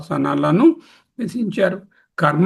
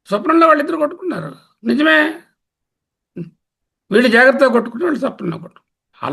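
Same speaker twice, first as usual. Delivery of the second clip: excited; 8 semitones higher, much faster than usual. Real, not acted. Teacher excitedly posing dream quarrel puzzle.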